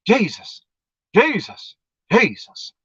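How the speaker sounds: high, gasping cries of Jesus, fading down; desperate pleading. Retold or imitated. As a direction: call out the name breathless, fighting for air. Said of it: "I'd start doing it again".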